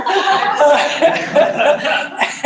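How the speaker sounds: a long, high burst of real laughter; high delighted laugh. Real, not acted. Laughs after spotting the Cutco knives.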